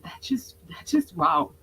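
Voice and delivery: breathless whispered wow; awed whisper. Real, not acted. Coach rereading her therapy notebook, awed whisper. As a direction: whisper it in amazement.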